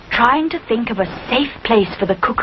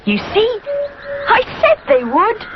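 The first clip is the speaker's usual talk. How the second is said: laughing little bear; 14 semitones higher than usual. A narrator's reading. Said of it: "laughs the little bear".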